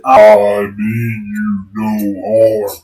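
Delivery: slow, lightly deepened giant voice; booming giant. Acted. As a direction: giant calling down, slow and steady. Tree giant voice again.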